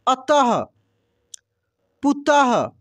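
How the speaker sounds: अतः said slowly with a clear breathy "ah" released after the last consonant; teacher drill, stretch the final ः into an audible "aha"; breathy visarga ending. Shown as a demonstration. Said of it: "अह का उच्चारण हो रहा है".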